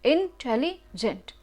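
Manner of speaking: Intelligent slowly split as इन-टेली-जेंट; demonstrating the stops inside intelligent; syllable breaks. Shown as a demonstration. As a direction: break intelligent into slow separate chunks. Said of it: "ये मोर syllable है".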